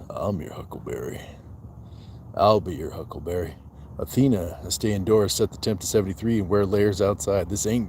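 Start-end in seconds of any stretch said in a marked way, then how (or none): 0.1-1.2 Doc Holliday impression. Streamer quoting a western film line, in a low quiet gunslinger voice. 2.3-3.5 a hint of the cool cowboy, less hushed; lighter movie quote. Streamer correcting his quote of the line, with a lightly lowered cowboy delivery.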